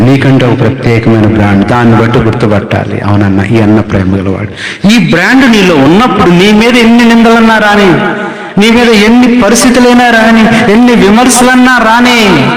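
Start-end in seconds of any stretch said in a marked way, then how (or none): 4.9-12.5 high, ringing climax, pushed up hard; full-force preaching. Acted. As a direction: lift the congregation with a soaring cry. (ఉచ్ఛస్థాయిలో ఉద్వేగంగా బోధించడం)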